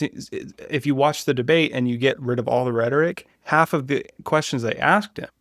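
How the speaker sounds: ordinary talk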